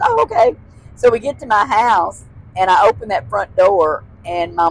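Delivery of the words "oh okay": put-on high, small girl's okay; a small child agreeing; little girl voice. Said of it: "And she goes".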